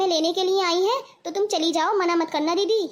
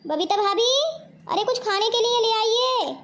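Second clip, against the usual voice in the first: hungry calling out; 5 semitones higher than usual. Acted. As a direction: call through the house to be served. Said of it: "इतनी जोर जोर से क्यों चिल्ला रहे हो".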